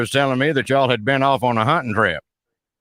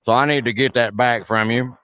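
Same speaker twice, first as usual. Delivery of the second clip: hammed-up backwoods Southern caller; put-on rural Southern accent; 2 semitones lower than usual. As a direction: play a blustering Southern good old boy on the phone. Roy Mercer character voice held.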